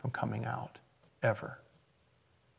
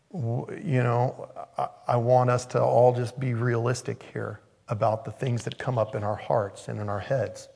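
ordinary talk

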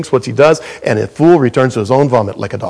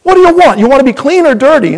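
parent asking; 11 semitones higher, 4 dB louder, faster than usual. Retold or imitated. Parent asks child at bathtime.

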